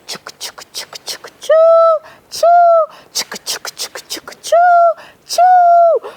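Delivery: whispered chugging then a high loud whistle; train approaching. A narrator's reading. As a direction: make the train come closer, whistle blasting.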